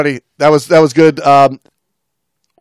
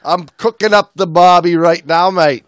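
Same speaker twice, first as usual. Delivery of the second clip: higher, playful mock Aussie voice; mock Australian accent; 6 semitones higher, 3 dB louder than usual. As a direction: toss it back in a jokey Australian accent. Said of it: "Australian accents".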